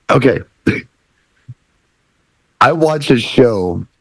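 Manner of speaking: ordinary talk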